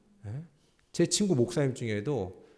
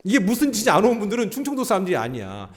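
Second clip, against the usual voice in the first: usual speaking voice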